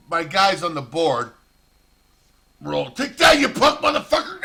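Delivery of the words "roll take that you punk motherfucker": shouted trash talk, much higher and louder; mock taunting yell. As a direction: bark it at the game pieces like a psycho. Psycho taunting his Risk pieces.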